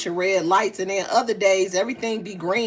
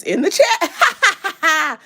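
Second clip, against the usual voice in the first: laughing; 8 semitones higher, 5 dB louder, much slower than usual. Real, not acted. Laughs reading comment.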